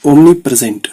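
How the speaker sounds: omnipresent stretched out slowly; teacher dictating a word to a shorthand class; slow dictation. Shown as a demonstration. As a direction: draw out omnipresent for note-taking. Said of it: "जैसे मान लो omnipresent है".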